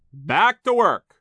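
slow, high, loud foreman's shout. A narrator's reading. Foreman breaks up the chat, shouting. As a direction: bellow an order across the mill. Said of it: "he shouted".

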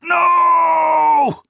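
very high, loud piteous howl. Acted. The blinded marshal as the knife falls, piteous howl. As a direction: howl it in pure agony. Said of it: "a piteous voice howled".

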